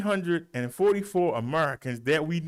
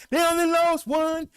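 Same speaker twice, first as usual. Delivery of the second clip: high, loud exclamation; excited high-pitched announcement; celebratory cry; 13 semitones higher, 5 dB louder than usual. Real, not acted. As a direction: call it out high like great news. Celebrating a low death count.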